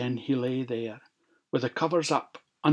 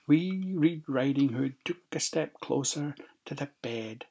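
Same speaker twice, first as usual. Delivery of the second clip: ordinary delivery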